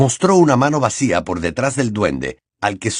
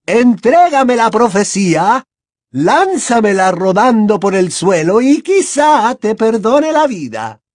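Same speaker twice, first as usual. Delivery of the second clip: slow, high, loud demands; Bellatrix shouting; 12 semitones higher, 6 dB louder than usual. A narrator's reading. Bellatrix demanding the prophecy from Potter, shouted. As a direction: shout it drawn-out, as a menacing villainess. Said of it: "gritó Bellatrix".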